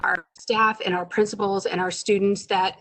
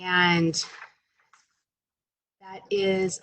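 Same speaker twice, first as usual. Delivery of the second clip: ordinary delivery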